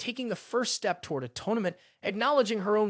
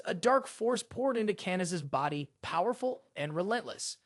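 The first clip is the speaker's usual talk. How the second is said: ordinary delivery, nothing marked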